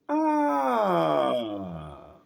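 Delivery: long high cry sliding down; echoed scream. A narrator's reading. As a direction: echo the scream, letting it fall slowly away. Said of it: "repeating somewhere".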